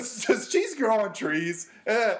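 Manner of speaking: slow, high-pitched mock joke delivery; mocking impression. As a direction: mimic a friend setting up a lame joke. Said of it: "He's like".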